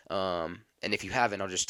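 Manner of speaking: usual speaking voice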